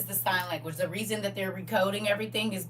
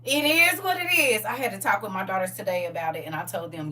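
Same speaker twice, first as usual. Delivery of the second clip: ordinary delivery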